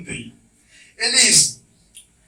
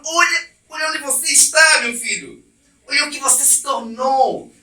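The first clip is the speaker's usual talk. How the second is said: louder, higher pleading call; pleading God; 6 semitones higher, 3 dB louder than usual. Acted. God pleads with his son Adam, loud and high. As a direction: beg him to look at himself, father to son. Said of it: "o próprio Deus pregando, fala".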